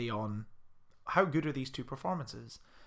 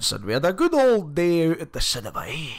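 Scottish accent impression; 5 dB louder than usual. Imitating Margot Robbie's Scottish accent in the film, mock brogue. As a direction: ham up a thick Scottish brogue. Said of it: "Margot Robbie's accent".

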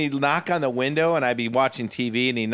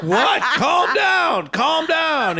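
calm down shout; 14 semitones higher, much slower than usual. Retold or imitated. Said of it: "I'm like".

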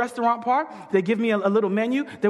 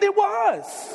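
gushing high; 11 semitones higher than usual. Retold or imitated. Gushes agreement with lady.

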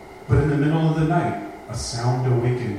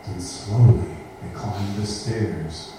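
slow suspense; 5 semitones lower, slower than usual. A narrator's reading. Building suspense in scary story.

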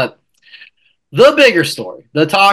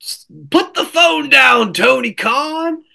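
high shouted plea; mock shout; 10 semitones higher than usual. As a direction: yell it at him, half joking. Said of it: "there's one thing to say".

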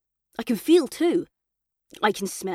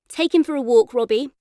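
Mum ordering; 4 semitones higher, 6 dB louder than usual. A narrator's reading.

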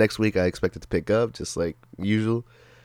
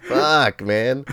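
usual speaking voice

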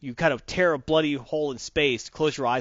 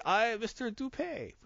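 mock French noble; 8 semitones higher, 4 dB quieter than usual. Player mimics his noble introducing himself, mock French accent. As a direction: announce yourself grandly as a French noble. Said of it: "you say".

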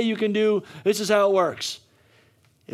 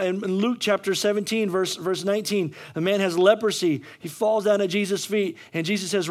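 ordinary talk

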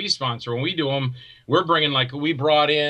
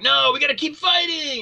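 high, loud hammed-up resolve; rally yourself in a squeaky put-on voice; playfully mimicking a stubborn homeowner; mock determined cry; 11 semitones higher, 4 dB louder than usual. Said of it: "And then you're like".

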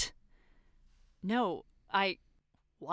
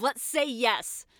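bitter; 5 semitones higher, 5 dB louder, much slower than usual. Acted. Bitter, slow concession.

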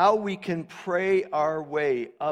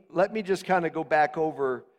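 ordinary delivery

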